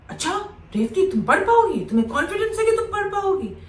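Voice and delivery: high-pitched, disbelieving teacher questioning a pupil; mocking teacher's challenge. (ताना मारते teacher की नकल, ऊँचे सुर में)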